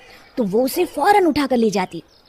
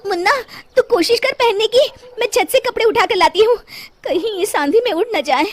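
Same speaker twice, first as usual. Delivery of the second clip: hurried mother; 8 semitones higher than usual. Acted. Mother hurrying in a storm.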